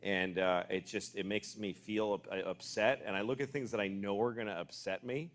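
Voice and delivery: confessing phone habits, soft and low; quiet confession. Real, not acted. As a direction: drop it down, almost private. Confessional bit about phones.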